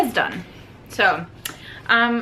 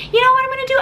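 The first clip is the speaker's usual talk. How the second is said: high, loud mock Edward voice, smug; 6 dB louder, much faster than usual. Reviewer mocks Edward deciding to coerce Bella, high smug voice. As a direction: ham up the boyfriend's scheming line. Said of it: "he decides".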